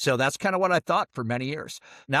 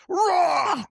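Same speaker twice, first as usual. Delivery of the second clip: high, loud mock roar; bully roaring while ripping the shirt; 5 dB louder than usual. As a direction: bellow it like an angry brute. Big kid attacking.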